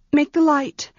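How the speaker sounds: high, urgent begging; frightened plea. Acted. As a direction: plead in the dark, scared. Frightened girl begs in dark.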